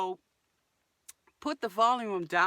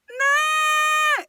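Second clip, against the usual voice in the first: high, loud cry of injustice; yelling no like a hurt child; pained shout; 9 dB louder than usual. Shown as a demonstration. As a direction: shout from real frustration. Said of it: "It comes from pain".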